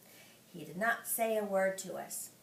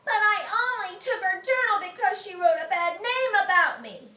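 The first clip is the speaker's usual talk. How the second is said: May arguing; 9 semitones higher, 7 dB louder than usual. Acted. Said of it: "she said".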